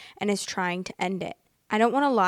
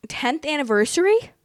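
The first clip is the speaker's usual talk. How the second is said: high, loud incredulous question; can't believe what she just read; stunned, repeating the detail back; shocked disbelief; 7 semitones higher, 6 dB louder than usual. Real, not acted. Shocked on rereading the post.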